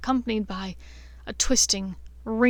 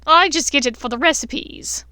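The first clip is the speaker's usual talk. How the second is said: leering; 5 semitones higher, 7 dB louder than usual. A narrator's reading. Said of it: "she leered towards Draco".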